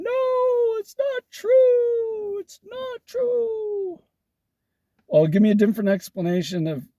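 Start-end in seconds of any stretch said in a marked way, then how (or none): 0.0-4.0 very high, slow, whiny falsetto wail; hammed-up sobbing no, drawn out; high weepy mock protest; mock crying denial. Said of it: "is cringing and crying and going".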